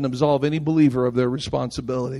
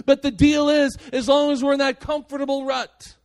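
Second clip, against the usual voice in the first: high, forceful sermon delivery; impassioned preaching; 9 semitones higher, 4 dB louder than usual. Acted. Preacher charging believers with responsibility for the lost, impassioned. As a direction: drive each phrase home from the pulpit.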